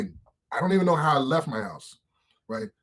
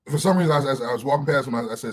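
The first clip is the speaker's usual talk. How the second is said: usual speaking voice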